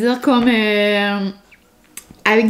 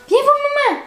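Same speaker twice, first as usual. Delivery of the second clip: doggy mom voice. Real, not acted. Calling her dog Molly over, high cooing baby talk. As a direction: coo at the puppy like a doting mom. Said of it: "J'ai une voix de mère, de petit chien".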